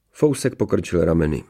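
plain reading voice

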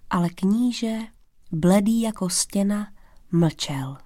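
ordinary delivery

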